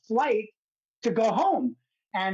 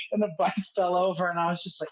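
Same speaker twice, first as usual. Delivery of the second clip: ordinary delivery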